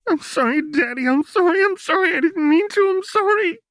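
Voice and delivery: high, loud sobbing apology. A narrator's reading. Jinx sobbing sorry to her father. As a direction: wail the apology through sobs. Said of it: "Jinx sobbed".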